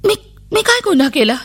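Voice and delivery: put-on frightened child. A narrator's reading. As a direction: ask it trembling with fear, like a little girl. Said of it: "मी घाबरून गेले".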